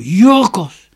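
loud, high call of her name. A narrator's reading. Father calls his daughter through the fog, loud high call. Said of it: "hoorden ze hem roepen".

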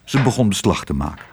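plain reading voice

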